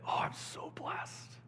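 slow, breathed-out whisper of gratitude; awed whisper. Acted. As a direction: whisper it slowly, as if overwhelmed. Contrasts hushed and exultant takes.

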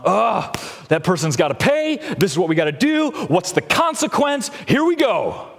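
rushing to make someone pay; eager justice seeker. Retold or imitated. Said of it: "some people are more justice-oriented".

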